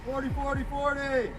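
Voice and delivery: high urgent callout; shouted callout. Real, not acted. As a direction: call it out loudly. Officer calling launcher round.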